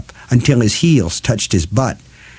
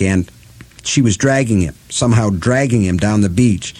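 plain reading voice

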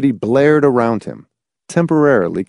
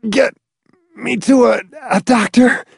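strained plea; 12 semitones higher than usual. Acted. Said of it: "he managed".

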